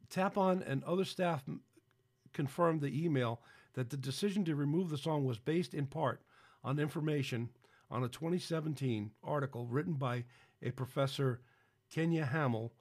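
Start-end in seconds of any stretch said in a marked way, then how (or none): none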